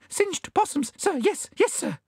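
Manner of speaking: very high, nervous bandicoot squeak; squeaky, servile fright; gulped in fear. A narrator's reading. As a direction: gulp out anxious agreement. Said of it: "gulped the bandicoot".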